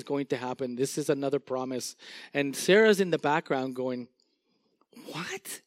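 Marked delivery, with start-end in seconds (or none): 5.1-5.6 startled what. Acted. Sarah voiced scoffing.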